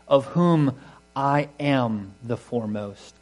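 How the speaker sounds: slow, weighty "I am the foremost"; stress on I am. Shown as a demonstration. Preacher stressing Paul's present tense, slow and weighty. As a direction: lean hard on "am", drawn out. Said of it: "it's actually quite emphatic".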